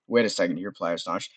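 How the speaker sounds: ordinary talk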